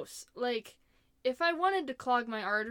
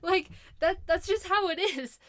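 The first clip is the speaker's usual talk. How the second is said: a touch higher and louder, still laughing; real laughter spilling into the words; 7 semitones higher, 7 dB louder than usual. Real, not acted. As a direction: shrug it off through a laugh. Laughing after the punchline.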